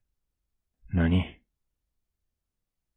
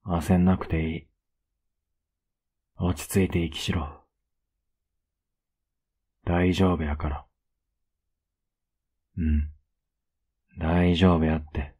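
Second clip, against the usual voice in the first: soothing. Acted. Soothing a panicking partner. (優しくなだめるように)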